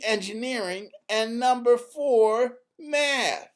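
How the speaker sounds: put-on a bright young girl spelling out STEM; Izzy explaining. A narrator's reading. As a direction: list the letters like a proud teacher.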